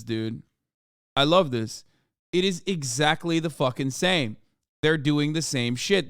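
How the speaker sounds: ordinary talk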